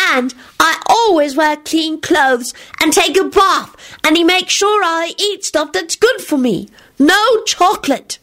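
put-on high, slow little-boy boasting; untruthful child. A narrator's reading. Teddy brags untruthfully to the guest, high and earnest. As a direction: recite it slowly like a virtuous child. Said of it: "he added untruthfully".

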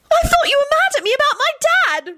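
put-on very high girlish wail. A narrator's reading. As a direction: wail it at the top of your voice range. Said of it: "Rosie wailed".

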